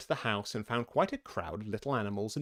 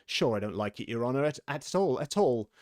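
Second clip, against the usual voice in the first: ordinary delivery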